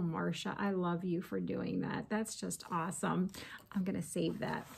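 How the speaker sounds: soft delighted whisper. Real, not acted. Whispering to herself about saving Marsha's confetti. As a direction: whisper it to yourself, pleased.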